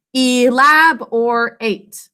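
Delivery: ELABORATE drawn out slowly in four syllables, E-LAB-OR-ATE; sounded out. Shown as a demonstration. Teacher sounds it out with pupils, ELABORATE slowly syllable by syllable. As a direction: stretch each syllable of ELABORATE, full "or" and "ate". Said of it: "Sound it out with me".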